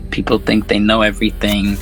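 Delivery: ordinary talk, nothing marked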